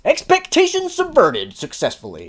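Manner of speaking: mock announcement. Mocking the showrunners with a triumphant pronouncement. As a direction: declare it like a smug system message. Mock first thought about scene.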